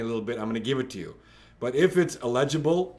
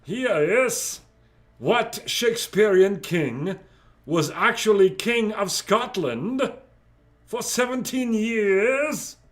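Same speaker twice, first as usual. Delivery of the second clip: high, loud theatrical put-on voice; hammy stage character; 7 semitones higher, 4 dB louder, slower than usual. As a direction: declaim it grandly, stretching the last words. Acts out the question theatrically.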